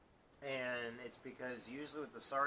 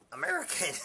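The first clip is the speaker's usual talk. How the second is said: high, loud, breaking into laughter; laughing at the absurdity; incredulous laugh; 10 dB louder than usual. Real, not acted. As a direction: squeak it out in disbelief.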